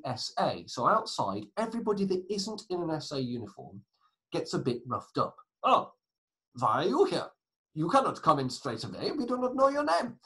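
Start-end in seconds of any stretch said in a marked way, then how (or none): 5.6-10.2 hammed-up German doorkeeper, louder; appalling German accent. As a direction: challenge them in a bad German accent. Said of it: "That was an appalling German accent".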